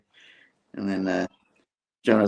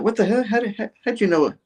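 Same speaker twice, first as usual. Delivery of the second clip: much higher alarmed voice; mock shock; 13 semitones higher than usual. Harry reacts with alarm as Tony presses buttons, high and startled. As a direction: act startled by the suit. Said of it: "they're all like".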